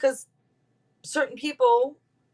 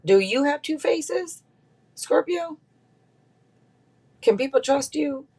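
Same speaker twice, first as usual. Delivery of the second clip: teasing question; 9 semitones higher than usual. Acted. Reading asks Scorpio teasingly.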